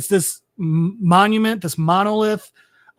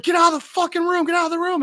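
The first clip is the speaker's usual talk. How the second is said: much higher, urgent mock shout; mock panicked yell; 10 semitones higher, faster than usual. Kids screaming at each other to flee, high mock yell. Kids fleeing alien attack.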